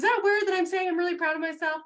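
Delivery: high, loud bashful question; imitating niece's worry. Niece asking if pride is weird, high and eager. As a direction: squeal it out, half shy.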